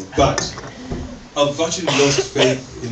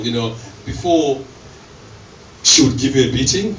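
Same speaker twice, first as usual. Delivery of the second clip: ordinary talk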